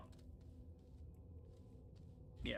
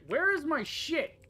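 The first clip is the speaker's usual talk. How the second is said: slow, loud, much higher yelp; frustrated outburst; 13 semitones higher, 9 dB louder, slower than usual. Real, not acted. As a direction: stretch out the cry of disbelief. Frustrated outburst at missing item.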